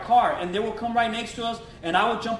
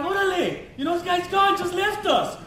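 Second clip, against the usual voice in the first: can't believe he ran off, said much higher; stunned disbelief; 9 semitones higher than usual. Retold or imitated. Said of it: "So I'm like".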